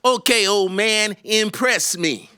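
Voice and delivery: high, slow challenge from bored third graders; sassy kids. Retold or imitated. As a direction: dare the old man to impress you. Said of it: "looking at you like".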